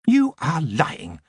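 cold, imperious queen voice, pitched high; haughty elf queen. A narrator's reading. Queen to goblin prisoner, imperious and cold. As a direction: speak down to him like a queen.

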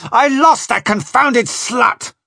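ずっと高く大声で、ゆっくり吐き捨てる; 逃げた女に怒り狂う船長; roaring in rage. Acted. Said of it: "Spring was roaring".

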